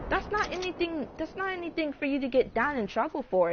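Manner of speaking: high, put-on voice brushing off the charge; mock officer voice. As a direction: play the officer shrugging it off. Officer dismissing the offense.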